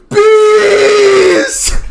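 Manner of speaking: very high, loud frantic scream; hammed-up panic shriek; yelling. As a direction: scream it at the top of your lungs. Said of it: "Just as loud as he can, just yelling".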